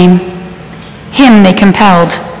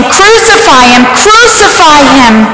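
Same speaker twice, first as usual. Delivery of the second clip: mob cry; 6 semitones higher than usual. Acted. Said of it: "they cried out".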